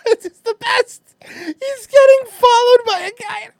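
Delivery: very high, squeaking through laughter; helpless laughter. Real, not acted. Streamer delighted the filmer is being followed, laughing hard. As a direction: barely get it out while cracking up. Cracking up at clip.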